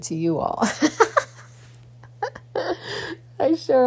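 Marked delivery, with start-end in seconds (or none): 2.2-3.1 a short, high burst of laughter at her own remark. Real, not acted. Vlogger laughing at her own joke to viewers, high laugh. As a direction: laugh it off, amused at yourself.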